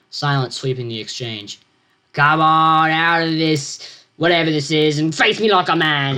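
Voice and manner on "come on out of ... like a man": louder, slow challenging roar. Acted. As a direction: bellow a challenge through the door. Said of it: "Alfred roared".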